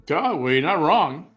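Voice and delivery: normal talking voice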